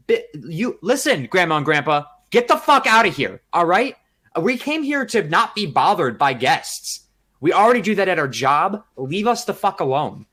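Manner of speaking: reenacting telling off nosy grandparents, loud and angry. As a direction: snap at the grandparents to get lost. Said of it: "And I go".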